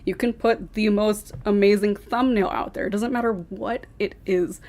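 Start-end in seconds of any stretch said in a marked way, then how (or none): none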